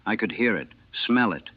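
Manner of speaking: ordinary delivery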